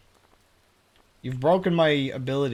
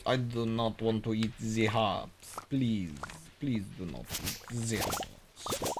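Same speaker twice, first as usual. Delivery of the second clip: mock plea; 6 dB quieter than usual. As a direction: plead quietly in a put-on voice.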